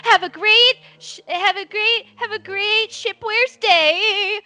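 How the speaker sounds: high, stretched-out singsong shout; kid calling back. Acted. Kid yells shop tagline running downstairs, high drawn-out call. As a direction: call a cheery slogan as you flee, drawing out the end.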